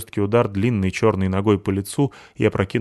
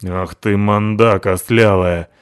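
angry insult; 7 dB louder, slower than usual. Acted. Перебранка Натахи и Абуты.

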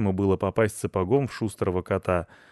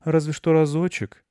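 high, hesitant mum voice; relenting mother; 9 semitones higher, 5 dB louder than usual. A narrator's reading. Mother allowing the dog for once, relenting. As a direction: give in reluctantly to your child. Mother gives in to her son.

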